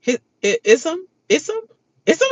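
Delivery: a hint of a puzzled rising question; imitating her confusion. Imitating the houseguest mishearing, lightly puzzled rising. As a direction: ask it like you can't make out the word. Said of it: "she was like".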